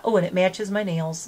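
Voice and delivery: ordinary delivery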